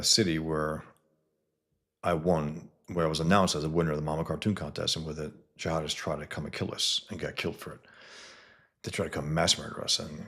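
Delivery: usual speaking voice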